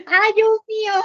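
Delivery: high, loud exasperated ay; exasperated cry. Real, not acted. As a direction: throw up your hands and cry out. Exasperated teacher exclaims. (grito exasperado de profesora)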